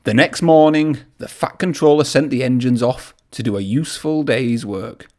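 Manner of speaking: ordinary delivery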